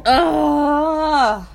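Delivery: loud frustrated yell; frustrated cry. Real, not acted. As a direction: let out an exasperated yell.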